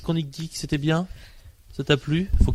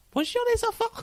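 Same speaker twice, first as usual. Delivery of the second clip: put-on very high, soft child voice; small young voice; 5 dB quieter than usual. Shown as a demonstration. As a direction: squeak it out like a little kid. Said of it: "je prends une petite voix jeune".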